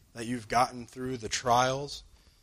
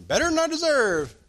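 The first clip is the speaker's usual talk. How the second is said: much higher, louder bright reply; cheerful answer; 7 dB louder than usual. Retold or imitated. Answering a morning greeting, bright and upbeat. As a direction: beam it back, upbeat and sure.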